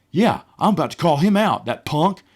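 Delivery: fired-up mock bravado; riled-up buddy. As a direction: act out a buddy itching to confront someone. Buddy riled up about the interviewee.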